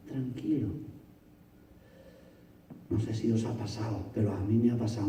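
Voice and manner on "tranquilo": soft, calm. Shown as a demonstration. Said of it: "y sigue escribiendo".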